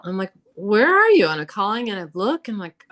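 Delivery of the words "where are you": loud, high call down the hallway. Retold or imitated. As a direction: shout it to someone out of sight. Calling through the house.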